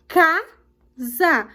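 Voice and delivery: Casa in two beats, CA strong, za dropped; teacher sounding out "Casa", hitting the first syllable; stress on CA. Shown as a demonstration. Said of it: "O K é mais forte".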